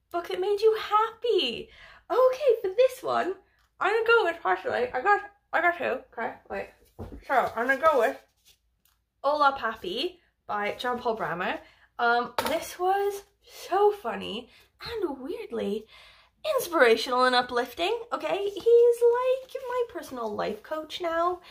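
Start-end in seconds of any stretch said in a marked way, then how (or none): 0.1-8.2 high, loud bubbly energy; bright happy rush; excited. Real, not acted. Reading happy-book prompt excitedly. 18.1-21.4 high, loud squeal of delight; real excited squeal; excited gush. Real, not acted. Gushing about favourite author.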